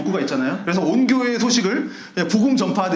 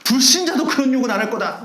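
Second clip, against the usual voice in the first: higher, outraged tirade; indignant rant; 5 semitones higher than usual. Retold or imitated. Church member rants about the cursing pastor, higher outraged tirade. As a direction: pour out the outrage in one breath. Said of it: "막 이렇게 하는 거죠".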